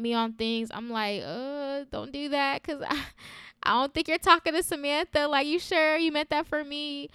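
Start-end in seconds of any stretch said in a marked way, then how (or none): 1.2-7.1 higher-pitched, doubtful brush-off of praise; bashful deflection. Retold or imitated. Replying to people who compliment her, bashfully disbelieving. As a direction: play yourself squirming out of a compliment, voice raised.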